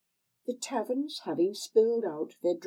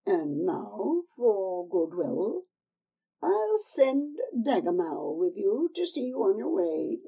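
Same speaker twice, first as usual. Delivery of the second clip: put-on old crone; 3 semitones higher, 4 dB louder than usual. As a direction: coax her like a sly old crone. Old woman calls Daggermal.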